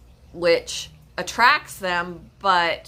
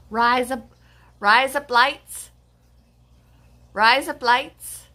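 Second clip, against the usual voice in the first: "rise up lights" slow, run together, higher; fake Australian accent; 5 semitones higher, 5 dB louder, slower than usual. Shown as a demonstration. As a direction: say "rise up lights" as Aussie "razor blades". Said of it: "Kinda sounds like an accent".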